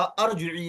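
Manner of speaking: अरजिई opening on "ar"; initial short a. Shown as a demonstration. Teacher rules out a misreading, अरजिई with initial "a". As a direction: say अरजिई with an "a" as first vowel. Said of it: "नहीं लगेगा".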